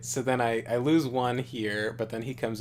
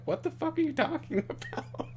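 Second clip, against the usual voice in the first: incredulous friends. Retold or imitated. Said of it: "they were all like".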